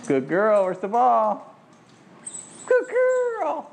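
high, loud praise; gushing praise for the dog; excited dog praise. Real, not acted. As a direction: cheer the dog on.

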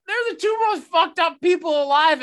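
shouting in disgust; exasperated outburst. Real, not acted. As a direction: throw your hands up in disbelief. Exasperated rant after reading texts.

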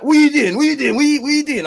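high, loud mock challenge; confrontational chef. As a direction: imitate a chef barking rapid questions. Acts out an angry chef confronting him.

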